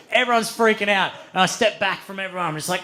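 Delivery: ordinary talk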